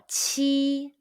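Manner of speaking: "qi" high and level; high first tone. Shown as a demonstration. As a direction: hold "qi" on a steady high note. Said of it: "and the high pitch first tone".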